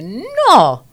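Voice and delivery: high, loud cry of no; shouted refusal. Retold or imitated. Little girl refusing to kiss the figure, high loud shout. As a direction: scream it out in refusal. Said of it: "yo pegué un grito".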